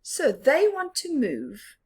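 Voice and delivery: ordinary delivery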